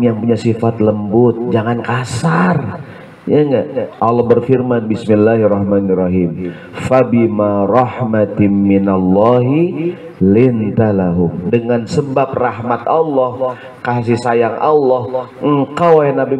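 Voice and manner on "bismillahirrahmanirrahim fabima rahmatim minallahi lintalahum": Quran recitation. Acted.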